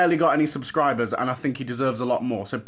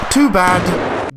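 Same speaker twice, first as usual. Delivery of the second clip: sudden loud, higher-pitched taunt; taunting shout; 5 semitones higher, 11 dB louder than usual. Acted. Trader springing the trick on a victim, taunting shout. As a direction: blast it in the victim's face.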